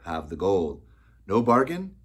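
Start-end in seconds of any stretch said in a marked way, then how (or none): 1.3-2.0 loud triumphant smile; smiling. Acted. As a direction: say it with a grin. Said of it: "I said with a smile".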